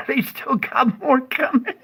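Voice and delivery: high, loud laughter breaking the line; laughing. Real, not acted. Reading the quake map to viewers, bursting into laughs. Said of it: "I'm losing it".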